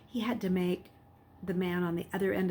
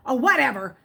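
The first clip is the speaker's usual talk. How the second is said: growled; 5 semitones higher, 7 dB louder than usual. A narrator's reading. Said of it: "the man growled".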